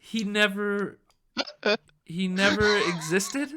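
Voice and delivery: high, halting bewildered question; stunned disbelief. Acted. Gaslit assistant repeating boss's words, stunned. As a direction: stammer it back, rising in disbelief.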